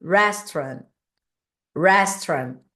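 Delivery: "Restaurant" with a curled-tongue English R; R enrolado do inglês. Shown as a demonstration. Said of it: "a gente meio que enrola a língua". ("Restaurant" com o R inglês, língua enrolada)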